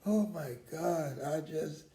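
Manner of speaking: usual speaking voice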